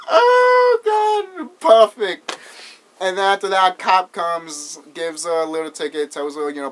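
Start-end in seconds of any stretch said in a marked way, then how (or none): 0.0-2.4 high-pitched, loud laughing sigh of delight. Real, not acted. Fan relishing a movie quote, laughing delight. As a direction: come out of a big laugh, delighted.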